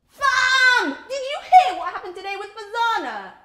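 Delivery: high, loud, bursting with news; excited friend. Acted. Friend phoning with news of Fazana, excitedly. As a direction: blurt out the big gossip, starting on a shouted greeting.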